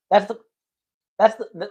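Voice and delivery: stuttering on the repeated words; real stammer, excited and caught. Real, not acted. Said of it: "nobody ever pointed out that I have a stutter".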